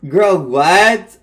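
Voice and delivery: a loud stunned reaction; loud disbelief. Real, not acted.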